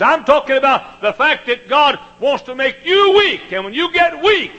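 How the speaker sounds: high, loud, driving pulpit voice; hammering the point home to the congregation; full-force preaching. Acted.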